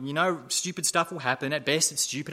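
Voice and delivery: usual speaking voice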